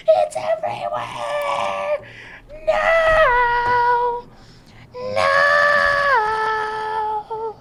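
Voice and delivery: very high, drawn-out squeal; wailing dismay. Real, not acted. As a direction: stretch it into a long despairing shriek. Spill has gone everywhere.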